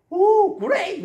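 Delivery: high, bright mock American cheer; cheerful exclamation. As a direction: gush it like an upbeat American. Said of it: "미국 사람들은 어떻게 해요?". (과장된 미국식 감탄)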